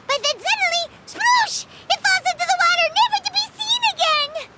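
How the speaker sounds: dramatic excitement. Acted. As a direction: squeal the splash like a tragedy. Dramatic lotus crisp splash story.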